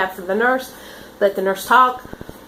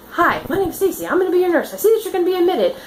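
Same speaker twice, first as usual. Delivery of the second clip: higher, lilting, soothing; gentle, sweet nurse voice; 8 semitones higher, faster than usual. Retold or imitated. Nurse greeting a crying patient, high and gentle.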